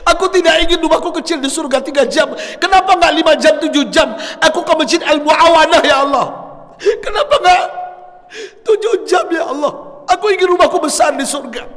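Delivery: high, loud anguished cry; shouting in regret. Retold or imitated. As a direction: wail it out as if begging God. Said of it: "Beliau berteriak sambil mengatakan".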